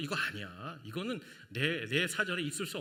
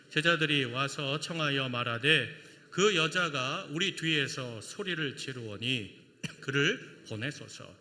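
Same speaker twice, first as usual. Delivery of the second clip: slow, measured, lower unison reading voice; slow scripture reading; 5 semitones lower, slower than usual. Shown as a demonstration. Pastor leading the congregation in reading scripture, slow and measured. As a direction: read the verse together, slowly and evenly. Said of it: "다 함께 읽겠습니다".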